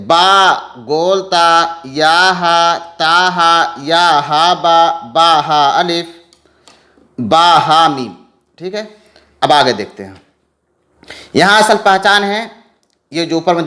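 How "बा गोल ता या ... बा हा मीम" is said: slow drill reading. Shown as a demonstration. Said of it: "फिर से पढ़ते".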